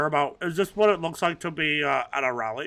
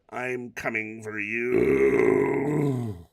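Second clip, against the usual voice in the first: villain threat; 5 semitones lower, much slower than usual. Acted.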